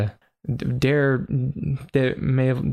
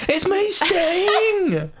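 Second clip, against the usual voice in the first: very high, drawn-out falsetto; hammed-up slow character catchphrase; Sting; much slower than usual. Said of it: "I like my version better".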